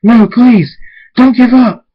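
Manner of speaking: loud, high, desperate begging; screamed plea. A narrator's reading. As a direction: scream a plea at a failing companion. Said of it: "Draconic screams".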